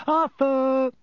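high, loud call for a husband. A narrator's reading. Wife summoning husband as train leaves, calling out. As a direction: call him from down the platform. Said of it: "called Missus Weasley".

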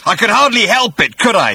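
high, loud furious bellow. A narrator's reading. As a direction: bellow it in outrage. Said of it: "he bellowed".

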